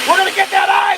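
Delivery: shouted high and loud; loud battle cry. Acted. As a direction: yell it like a war cry. Hyped battle cry at ice.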